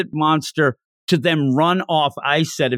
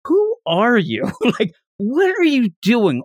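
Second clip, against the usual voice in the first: put-on kid scolding; 7 semitones higher than usual. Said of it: "This little kid has to say to him".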